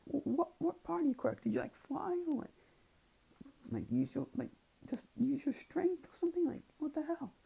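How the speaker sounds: put-on high squeaky disbelieving girl voice; baffled girl. A girl grills the winner about his quirk, in a high baffled squeak. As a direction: pepper him with questions in a high squeak. Girl questioning how he won.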